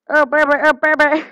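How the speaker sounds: hammed-up lover's moan; mock moaning. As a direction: ham up a silly moan. Mock moaning over humping.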